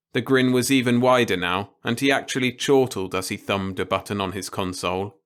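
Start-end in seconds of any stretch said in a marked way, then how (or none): none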